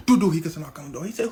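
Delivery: ordinary delivery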